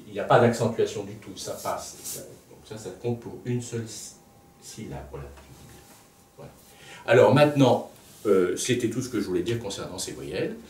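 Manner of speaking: ordinary talk, nothing marked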